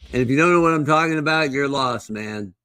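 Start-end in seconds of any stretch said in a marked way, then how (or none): none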